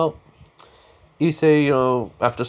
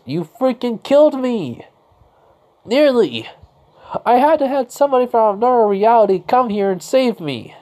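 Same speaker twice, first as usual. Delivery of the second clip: angry shout; 12 semitones higher, 8 dB louder than usual. Acted. Issei angrily confronts Renair.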